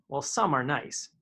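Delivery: ordinary delivery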